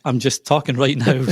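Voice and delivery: chuckling in amused protest; laughter bubbling under the words; amused, laughing. Real, not acted. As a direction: say it through a laugh. Amused by kids' accent request.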